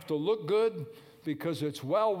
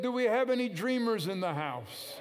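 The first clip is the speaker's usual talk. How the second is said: a louder second call to the congregation, a touch higher; repeated louder; 7 semitones higher, 4 dB louder than usual. Real, not acted. Preacher repeating his question to the crowd, louder. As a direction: call it out again with more push on have. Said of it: "I said".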